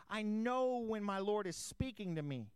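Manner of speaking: normal talking voice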